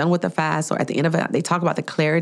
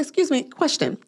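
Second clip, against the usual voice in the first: polite request; 10 semitones higher than usual. Retold or imitated. Voicing her polite request to barista.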